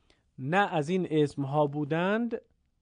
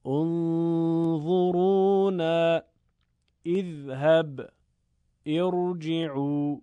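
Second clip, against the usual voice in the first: Quranic recitation demo. Shown as a demonstration.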